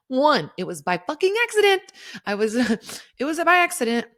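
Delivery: high, loud, fast protest; indignant outburst. Retold or imitated. Defending herself to poison control.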